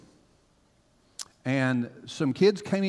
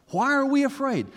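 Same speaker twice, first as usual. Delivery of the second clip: preaching climax; 11 semitones higher, 4 dB louder than usual. Acted. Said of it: "preaching question".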